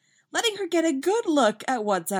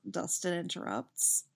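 usual speaking voice

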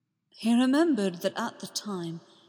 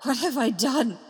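higher, louder, shattered realisation; shocked; 5 semitones higher, 5 dB louder than usual. Acted. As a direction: gasp it out in horror at yourself. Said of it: "shocked, shattered".

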